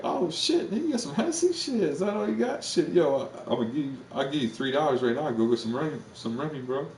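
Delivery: squeaky, amped-up bottle popper. Retold or imitated. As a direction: play the excited homie who spots the bottle.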